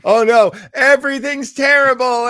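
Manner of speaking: loud, high hammed-up wail; mock despair. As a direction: overplay the doom. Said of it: "very much modern Star Trek".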